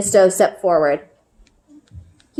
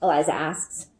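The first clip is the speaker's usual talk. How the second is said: ordinary delivery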